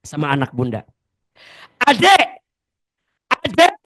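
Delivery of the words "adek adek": put-on loud, high calling out to a child; mother calling her child, rising each time. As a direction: call the kid, louder each time. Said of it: "Bunda sering nggak gini sama anak".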